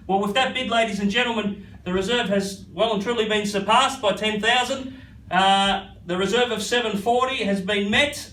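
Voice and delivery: rhythmic auction bid calling; auctioneer calling. Real, not acted. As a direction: keep the bids rolling.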